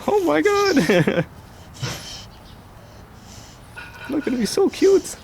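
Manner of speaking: very high, slow cooing. Real, not acted. Gushing up high over his cute dog. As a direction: melt over the puppy, up high.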